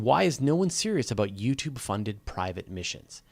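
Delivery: ordinary talk, nothing marked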